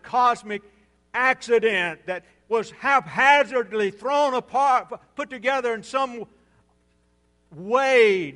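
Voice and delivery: loud pulpit voice climbing in pitch; forceful, building sermon delivery; impassioned preaching. Real, not acted. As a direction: hammer the point home from the pulpit.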